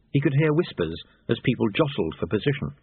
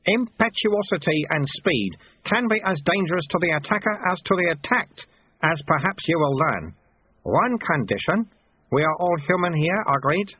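higher-pitched, deliberate master; old martial teacher in a raised voice; old sweeper Lutze; 7 semitones higher than usual. A narrator's reading. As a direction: instruct the pupil, then seek agreement.

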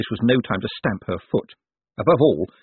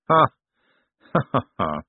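short, measured "ha", then a run of ha-has; dry laugh; 3 semitones higher than usual. A narrator's reading. Vetinari laughs before his puzzled secretary, dryly. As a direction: laugh in separate, deliberate bursts.

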